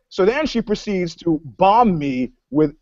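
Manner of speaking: normal talking voice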